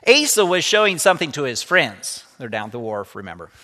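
lively read-aloud storytelling voice; story narration. A narrator's reading. Teacher reading a story to the class, lively read-aloud. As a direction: bring the story alive for the students.

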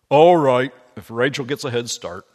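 lightly voiced hearty old miller. Miller answering Obadiah, lightly voiced hearty old man.

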